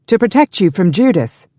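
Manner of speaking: loud, high, merry delivery; laughing quip. A narrator's reading. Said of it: "Stephen laughed".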